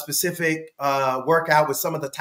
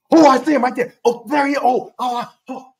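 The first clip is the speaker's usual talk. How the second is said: higher, louder eager exclamations; excited spotting; 7 semitones higher, 7 dB louder than usual. Retold or imitated. Proud father spotting his son in the team video, excited. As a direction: point him out excitedly.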